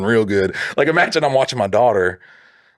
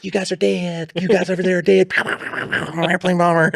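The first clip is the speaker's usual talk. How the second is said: put-on high, childlike playground war voice; hammed-up high announcement through giggles; pretend bomber pilot; 9 semitones higher than usual. Reenactor playing airplane over the field, high silly voice.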